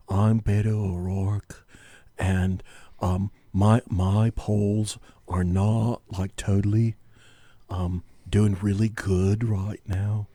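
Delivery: eighties Valley dude, low and slow; Beto O'Rourke. Acted. Host demonstrating his impression, slow low Valley dude voice. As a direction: draw it out like a laid-back Valley dude. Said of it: "do a good Beto O'Rourke voice".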